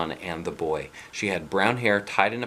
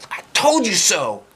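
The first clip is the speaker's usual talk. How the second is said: much higher, louder gloating cry; triumphant boy; 13 semitones higher, 8 dB louder than usual. Acted. Boy gloating at teacher, triumphant shout. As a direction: crow it in triumph.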